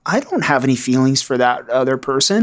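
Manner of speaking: mock incredulous, pitch leaping up; gaslighting denial. Addict husband denying feelings for another to his wife, incredulous. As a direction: act out an innocent, baffled protest. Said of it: "it was gaslighting".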